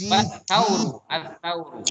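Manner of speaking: "Wat-tauru" with the t doubled; tajwid reading. Shown as a demonstration. Teacher models the reading for pupils. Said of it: "alif lam syamsiah".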